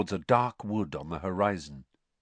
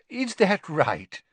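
1 polite stalling; 9 semitones higher, 4 dB louder, slower than usual. Acted. Stalling for his change.

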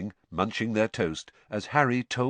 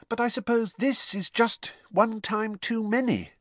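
much higher, steady explaining tone; patient Hermione; 13 semitones higher than usual. A narrator's reading. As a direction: explain it gently but firmly. Hermione explaining Ron's feelings.

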